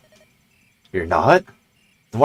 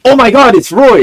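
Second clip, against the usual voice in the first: high, loud burst of excitement; excited squeal; 14 semitones higher, 8 dB louder than usual. Real, not acted. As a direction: shriek with delight at spotting him. Excited at seeing Roy.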